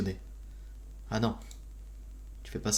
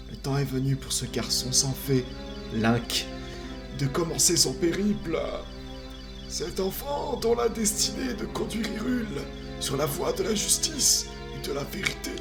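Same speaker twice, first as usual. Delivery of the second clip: higher, solemn ancient tree spirit; wise old tree; 10 semitones higher than usual. Acted. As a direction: speak as a grave forest guardian. Voices the Deku Tree.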